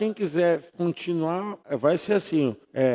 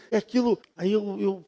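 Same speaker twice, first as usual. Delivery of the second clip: normal talking voice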